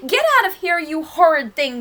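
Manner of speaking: loud, high, indignant Granny; furious cry. A narrator's reading. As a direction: shout the intruder out in outrage. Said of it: "Granny was furious".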